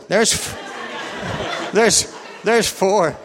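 high, slow, stumbling through laughter; breaking up laughing. Real, not acted. Counting his wife's continents for the congregation, helpless with laughter. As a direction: try to get the count out while laughing.

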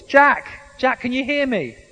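loud, calling down from on high; voice from above. Acted. God calls down to Jack on the cliff. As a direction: call his name from far above. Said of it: "he heard a voice".

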